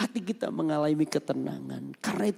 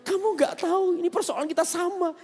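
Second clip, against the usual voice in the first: tearful; 11 semitones higher than usual. Retold or imitated. Pastor weeping over shared problem.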